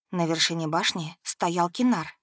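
plain reading voice

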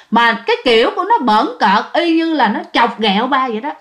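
ordinary delivery

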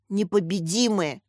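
ordinary delivery, nothing marked

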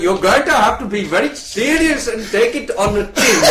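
loud, laboured comic dialect; hammed-up foreign voice, heavily spelled out; put-on character voice. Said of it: "You're doing a different voice".

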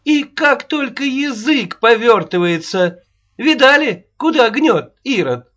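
put-on high, loud scolding old woman; shrill nagging grandma. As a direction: rail at him like an angry nanny. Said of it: "Снова не выдержала Аннушка".

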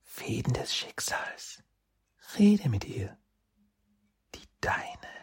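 eerie soft whisper inside the head; whispery telepathic voice. Acted. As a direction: breathe it like a fox spirit in his mind. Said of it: "Kitsunes Stimme in seinem Kopf".

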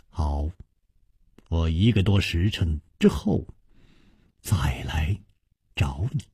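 low, hushed reply; quietly voiced Chu Liuxiang. Chu Liuxiang promising to return, low and hushed. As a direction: drop to a soft, low near-murmur.

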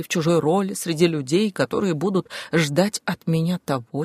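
plain reading voice

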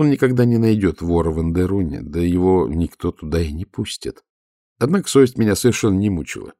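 usual speaking voice

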